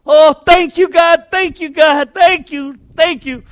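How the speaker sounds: high, loud, thrilled thank-yous; overjoyed thanks. Retold or imitated. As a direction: burst out in joyful gratitude. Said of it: "the woman sees the bread".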